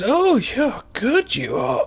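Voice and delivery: high, slow, drawn-out praise; mock admiration. Voicing her praise for finding it, high drawn-out admiration. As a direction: stretch it out in playful admiration.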